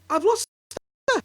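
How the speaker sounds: high, loud frightened cry. Acted. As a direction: cry out, searching in panic. Wife panics.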